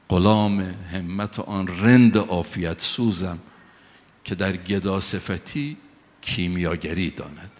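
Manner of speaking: slow, loud, measured verse recital; poem recitation. Acted. Preacher recites Hafez to the congregation, slow and solemn. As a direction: recite the couplet slowly and fully.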